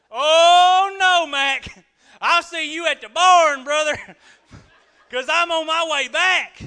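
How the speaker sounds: high, loud, laughing fake fright; mock panicked rider. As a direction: ham up a spooked rider backing off. Balaam replies to talking donkey.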